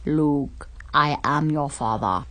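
lightly voiced low Vader line; a hint of Vader menace; Darth Vader voice. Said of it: "just like Darth Vader's".

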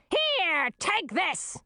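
high, strident scream of the queen; queen's scream. A narrator's reading. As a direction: scream it out as you hurl things. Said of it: "screamed the queen".

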